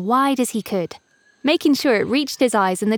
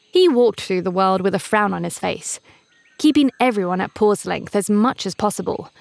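ordinary delivery

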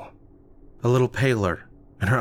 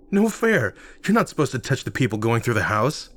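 indignant complaint; 3 semitones higher than usual. Acted. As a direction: yelp it out, offended. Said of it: "I complained".

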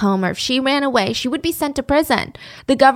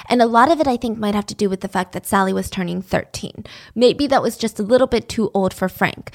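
ordinary talk, nothing marked

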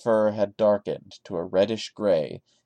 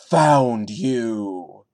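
creepy taunt; 8 dB louder than usual. Acted. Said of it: "with alternating caps and lowercase".

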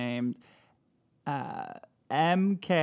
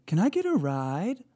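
higher, lightly voiced ghost plea; a hint of a lost hitchhiker; mock hitchhiker ghost; 5 semitones higher than usual. Joking option for the ghost's words. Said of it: "Is it C".

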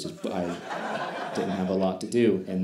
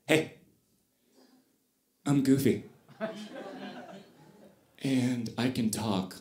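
plain own voice, not Goofy; 5 dB quieter than usual. Retold or imitated. Costumed Goofy to a kid, flat deadpan own voice. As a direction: say it flat, no character voice at all. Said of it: "I didn't use Goofy's voice. It was horrible".

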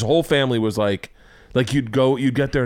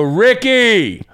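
loud high call across the bar; hammed-up loud calling of a name; calling louder; 11 semitones higher, 7 dB louder, much slower than usual. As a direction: yell the name to get his attention. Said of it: "And Norton goes louder".

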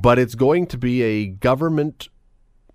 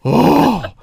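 mock shock; 13 semitones higher, 8 dB louder than usual. Mock horror at lunchbox contents.

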